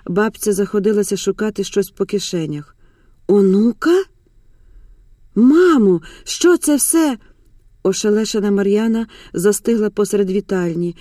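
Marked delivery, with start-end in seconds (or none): 3.3-4.0 astonished question, pitch climbing sharply; stunned echo. Acted. Изумлённо повторяет услышанное слово. 5.3-7.2 high, stunned appeal to mother; shocked demand. Acted. Ошеломлённая дочь требует объяснений.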